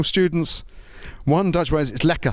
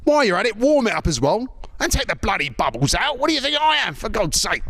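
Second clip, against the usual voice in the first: higher-pitched, put-on irate pub drinker; mock indignant customer; 5 semitones higher than usual. British drinker demanding his pint be topped up, mock indignant. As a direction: complain in rising comic outrage. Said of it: "you'd be like".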